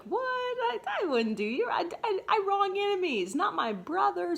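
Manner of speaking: high, stammering protest; flustered appeasing. Retold or imitated. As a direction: squeak out a denial to calm him. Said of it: "So he's kind of trying to appease him".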